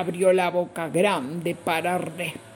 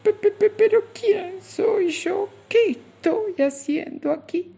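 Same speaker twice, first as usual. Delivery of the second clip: much higher, stuttering voice; stammering; 12 semitones higher than usual. Acted. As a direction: stammer in alarm. Bichito stammers again.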